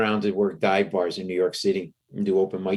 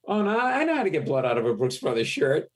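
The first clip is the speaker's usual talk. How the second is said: higher put-on know-it-all voice; slightly put-on advice voice; lightly voiced lawyer colleague; 7 semitones higher than usual. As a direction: imitate a helpful colleague, pitched up. Another lawyer offers laundry advice.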